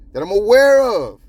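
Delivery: "aware" pushed up high and loud; emphatic stress. Real, not acted. Host qualifying his claim about Kentucky stories, "aware" stressed high. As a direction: hammer "aware" with a big rise in pitch.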